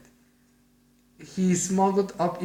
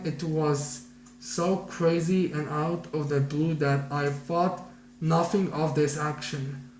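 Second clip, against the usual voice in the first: ordinary delivery, nothing marked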